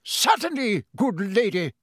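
high-pitched, gallant cry; Sir Cadogan. A narrator's reading. Painted knight answering the professor, gallantly crying out. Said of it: "cried Sir Cadogan".

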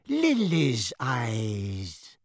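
put-on slow, wheezy old wizard. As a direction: breathe the words out, wheezing. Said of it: "a wheezy-voiced, silver-haired wizard".

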